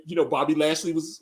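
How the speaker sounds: ordinary talk, nothing marked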